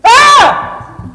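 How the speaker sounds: piercing high scream; startled scream. Acted. Storyteller acting the shepherds' fright, a high scream.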